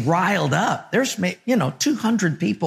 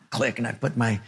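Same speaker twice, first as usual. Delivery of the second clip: ordinary talk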